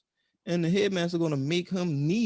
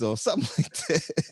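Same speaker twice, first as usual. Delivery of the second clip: ordinary delivery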